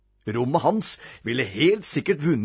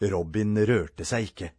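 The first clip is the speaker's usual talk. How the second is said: plain reading voice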